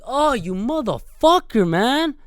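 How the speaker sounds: annoyed driver. Retold or imitated. Driver cursing a loud passing motorcycle, slow mock fury. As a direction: draw the curse out at the passing biker. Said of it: "and I'm like".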